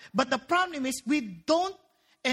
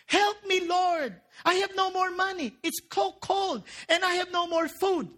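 higher-pitched acted pleading; old lady's plea; 5 semitones higher than usual. Old lady praying on her porch for food, high pleading. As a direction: cry out to heaven in mock need. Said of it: "raised her hands to the sky and said".

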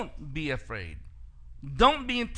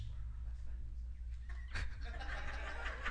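real airy laughter at his own joke; breathy laugh; 7 semitones higher, 14 dB quieter than usual. Real, not acted. Pastor laughing at the joke about old pastors, airy laugh. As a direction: laugh softly, amused.

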